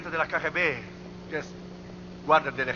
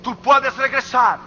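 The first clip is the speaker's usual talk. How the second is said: high, loud exhortation in spaced phrases; forceful preaching; 7 semitones higher, 8 dB louder than usual. Real, not acted. Preacher urges the congregation to return, forceful preaching. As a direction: call them back, punching out each phrase.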